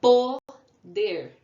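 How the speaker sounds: Poder called out loud, Po, then der; syllable by syllable. Shown as a demonstration. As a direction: punch a long Po, pause, then der. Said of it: "Duas sílabas".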